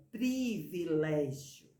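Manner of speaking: "privilégio" lento, com pri bem claro, com i; sílaba inicial destacada. Shown as a demonstration. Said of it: "A primeira sílaba". ("privilégio" devagar, marcando o i da primeira sílaba)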